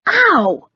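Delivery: sharp high yelp of pain; pained cry. Acted. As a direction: yelp as if hit. Knee to the head.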